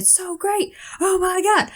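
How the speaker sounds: high-pitched awestruck gushing. Retold or imitated. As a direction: swoon in a high, thrilled voice. Retold ecstatic reaction.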